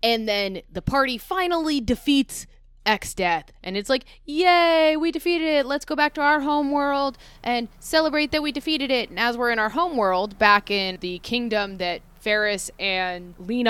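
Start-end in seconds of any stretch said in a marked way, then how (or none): 4.2-9.1 mock cheer. Mock party cheering victory.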